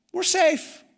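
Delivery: high, sing-song mock reassurance; a high mock-smug "we're safe"; smug mock confidence. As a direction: play the smug churchgoer brushing it off. Congregation's smug excuse mocked.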